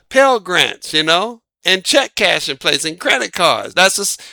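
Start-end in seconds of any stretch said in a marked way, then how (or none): none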